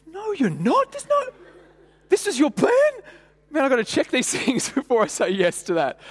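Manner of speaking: high, loud disbelieving outburst with a laugh; mock panic laughing at himself; incredulous panic. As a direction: react like you can't believe this plan. His inner panicked reaction.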